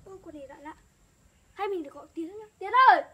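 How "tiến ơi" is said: loud call across the yard. Real, not acted. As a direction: shout his name to fetch him. Calling someone from afar.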